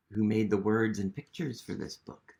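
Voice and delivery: plain reading voice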